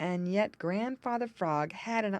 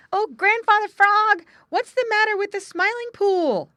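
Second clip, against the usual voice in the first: high, loud breathless call; breathless shout; 12 dB louder than usual. A narrator's reading. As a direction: shout it arriving winded from the race. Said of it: "they shouted as they came up quite out of breath".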